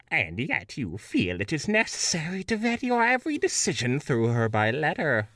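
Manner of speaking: lightly voiced disdainful witch; Wicked Witch voice. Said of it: "make her sound like the Wicked Witch of the West".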